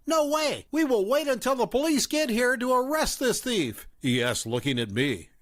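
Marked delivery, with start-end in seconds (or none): 0.1-3.9 higher-pitched accusing mother; raised mom voice. A narrator's reading. Mom confronting the narrator at the fast food place, accusing. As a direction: play the suspicious mom, pitched up.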